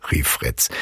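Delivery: plain reading voice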